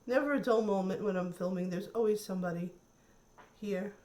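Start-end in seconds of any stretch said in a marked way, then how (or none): none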